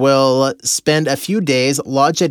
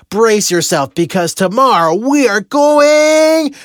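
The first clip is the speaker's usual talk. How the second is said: higher and louder, stretching going out long and slow; corny grand announcement; 8 semitones higher, 4 dB louder, slower than usual. Retold or imitated. Husband announcing the surprise excursion to his wife, hammed-up showman build. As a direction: build it up like a cheesy game-show reveal. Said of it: "turned it into a really corny ceremony".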